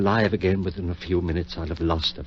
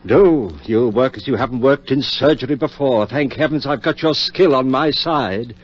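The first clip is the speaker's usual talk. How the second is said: loud, higher, driving orders; fervent command; 6 semitones higher, 6 dB louder than usual. Acted. Surgeon rallying his friend at the operating table, loud and fervent. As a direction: fire him up to work.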